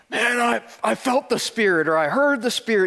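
high-pitched mock enthusiasm; excited churchgoer. Churchgoers telling people they felt the Spirit, mock excitement. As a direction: imitate gushing believers, pitched well up.

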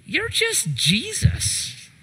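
high, slow, mocking "You're just Jesus"; dismissive mock. The crowd brushing off Jesus, in a high mocking voice. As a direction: sneer it like he's nobody special.

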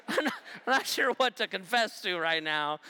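laughing evasion. Retold or imitated. Teen dodging mom, laughing.

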